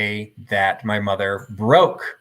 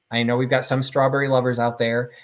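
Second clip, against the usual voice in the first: usual speaking voice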